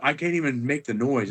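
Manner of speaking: ordinary delivery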